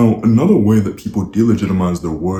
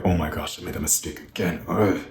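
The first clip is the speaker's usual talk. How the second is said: softer, mock self-annoyed mumble; a light imitation of someone beating themselves up; quieter, dejected "ugh" after a slip; frustrated groan; 7 dB quieter than usual. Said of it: "they would hyperfixate on it".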